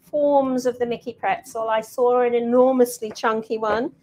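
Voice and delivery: normal talking voice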